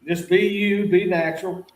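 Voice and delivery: ordinary talk, nothing marked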